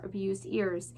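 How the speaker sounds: usual speaking voice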